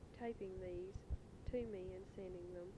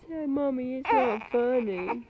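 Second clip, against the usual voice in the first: high, loud sing-song cooing to a crying infant; 6 semitones higher, 25 dB louder, much slower than usual. Real, not acted. Mother soothing her crying baby daughter on tape, loud high baby talk.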